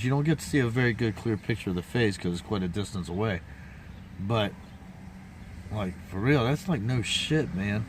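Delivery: ordinary delivery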